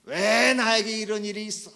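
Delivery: loud, high indignant protest; acted angry outburst. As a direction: cry out like someone enraged at their lot. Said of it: "막 화가 나요".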